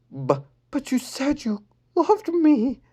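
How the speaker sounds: put-on slow, hurt high girl voice; heartbroken, slow and high; tearful. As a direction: hold back the tears. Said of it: "Lenny teared up".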